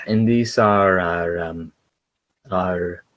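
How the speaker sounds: slow, hesitant, trailing off; halting, with drawn-out filler; searching for words. Acted. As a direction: stall while hunting for the right word. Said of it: "searching for words".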